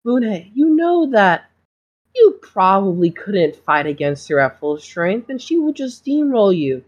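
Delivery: a smooth talking school doctor feigning innocence; smarmy doctor. Acted. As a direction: play the doctor as falsely sweet and wounded. GM holds doctor voice.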